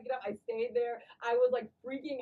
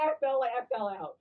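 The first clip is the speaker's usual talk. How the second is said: ordinary talk, nothing marked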